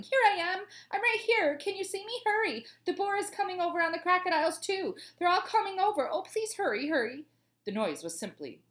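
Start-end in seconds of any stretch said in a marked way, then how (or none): 0.0-7.2 high, urgent cries for help; high-pitched pleading shouts; yelling. A narrator's reading. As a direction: yell frantically to be found and rescued.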